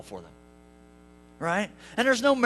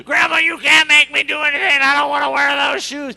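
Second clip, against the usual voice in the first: put-on defiant child shout; 4 semitones higher, 10 dB louder than usual. Four-year-old yelling back.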